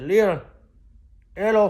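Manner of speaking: mock bold hello to a waiter; loud greeting. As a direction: hail the waiter boldly.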